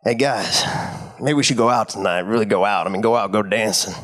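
low, suggestive invitation; drunk Gil voice. Retold or imitated. Imitating drunk Gil pushing the guys to go out, low. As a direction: drop the pitch and get a bit dirty. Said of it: "He's like".

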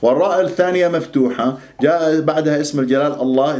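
ordinary talk, nothing marked

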